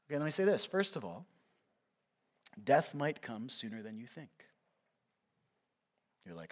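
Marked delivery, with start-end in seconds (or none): 2.6-4.3 low, hushed warning; grave warning. Real, not acted. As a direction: drop the voice for a sobering truth. Grave warning, later repeated firmly.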